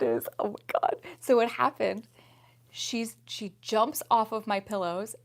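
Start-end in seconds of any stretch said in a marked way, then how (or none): none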